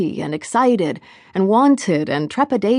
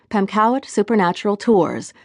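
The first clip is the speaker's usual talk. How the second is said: ordinary delivery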